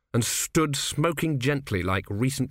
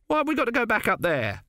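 much higher, naive lad's voice; young recruit; 11 semitones higher than usual. Acted. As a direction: whine it like a green young soldier. Narrator voices Autocue.

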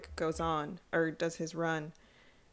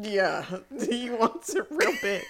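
words breaking up into laughter; real laughing; 6 semitones higher than usual. Real, not acted. Host giggling over innuendo in a quote, laughing through it. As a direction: try to finish the line while cracking up.

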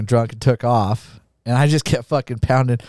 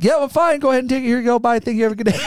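imitating himself, pitched higher; mock breezy; 11 semitones higher, much faster than usual. Wasted rider hurrying away from rental staff, high mock cheer. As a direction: rush the goodbye in a high put-on voice. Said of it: "I'm like".

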